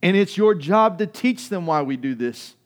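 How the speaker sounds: loud, high, emphatic sermon delivery; full-force preaching. Real, not acted. Preacher urging people to remember the tradition, full-force preaching.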